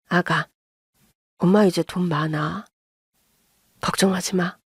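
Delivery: low, soothing mother's voice; tender reassurance. A narrator's reading. Mother reassures worried daughter about money, low and tender.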